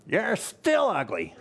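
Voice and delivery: high, drawn-out parrot voice; defiant parakeet. Acted. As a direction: insist on it like a stubborn bird. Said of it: "He puts the parakeet back on its perch".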